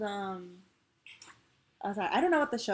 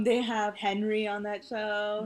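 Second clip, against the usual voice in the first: usual speaking voice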